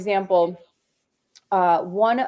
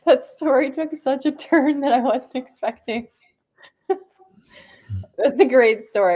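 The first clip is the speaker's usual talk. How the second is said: talking through laughter, pitched up; real high, laughing delivery; amused surprise; 6 semitones higher, 3 dB louder than usual. Real, not acted. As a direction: react laughing to an unexpected turn. Listener amused by story's dark turn.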